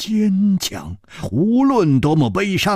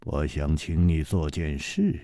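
put-on calm older hero; 13 semitones lower, 7 dB quieter than usual. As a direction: speak low and unhurried, gently reproaching. Chu Liuxiang requests.

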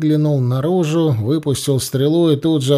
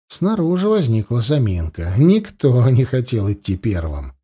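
usual speaking voice

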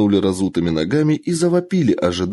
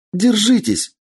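high urgent shout; 12 semitones higher, slower than usual. Acted. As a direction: yell it to riders holding on. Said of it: "выкрикнула Кицунэ".

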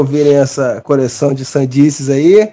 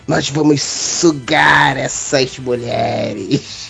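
sleazy movie line; 3 dB quieter, much slower than usual. Retold or imitated. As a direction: drawl it like a sleazy boss. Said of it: "vira pro outro e fala".

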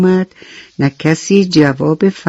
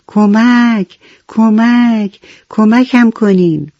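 higher-pitched, drawn-out mock cry; crying for help; 6 semitones higher, much slower than usual. A narrator's reading. Someone unseen calling for help in a children's story, mock cry. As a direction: call for help as a far-off victim, acted lightly.

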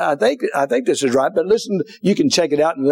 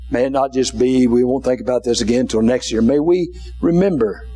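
prayer; 4 semitones lower than usual. Acted. Preacher praying over the congregation, low gentle prayer. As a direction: pray gently over the room. Closing prayer and altar call.

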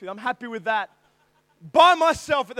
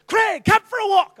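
loud high call; loud higher-pitched shout; 8 semitones higher, 8 dB louder than usual. Retold or imitated. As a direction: call him out of the van. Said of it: "I can't do the Swedish accent".

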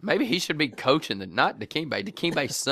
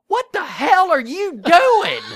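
high, loud, slow angry yell; furious shout; 10 dB louder, slower than usual. Retold or imitated. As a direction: yell at the kid who just sneezed on you. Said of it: "I was pissed".

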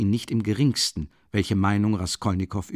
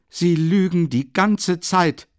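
loud, slow, higher outburst; shouted accusation; 7 semitones higher, 5 dB louder, slower than usual. Acted. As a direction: hurl the accusation at him, slowly. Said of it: "rief er aus".

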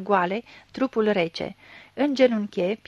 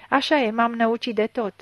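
plain reading voice

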